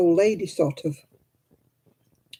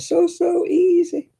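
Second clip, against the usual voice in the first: much higher, slow sarcastic drawl; 14 semitones higher, slower than usual. Real, not acted. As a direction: drag it out mockingly. Complaining about computerized knitting.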